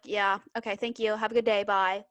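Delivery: normal talking voice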